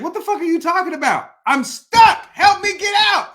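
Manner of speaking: loud, high, incredulous protest; exasperated outburst. Retold or imitated. Trapped man to useless therapist, exasperated yelling. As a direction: build from disbelief to a yelled plea.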